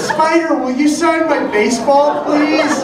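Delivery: put-on high, pleading little-kid voice; Zach. Little kid asking player for autograph, high pleading impression. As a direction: imitate a small fan begging a favour. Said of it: "And he says".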